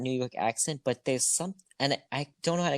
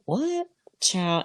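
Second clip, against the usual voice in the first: imitating [name]; 7 semitones higher, much slower than usual. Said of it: "and she said".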